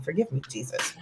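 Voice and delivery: ordinary talk, nothing marked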